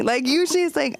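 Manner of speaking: ordinary delivery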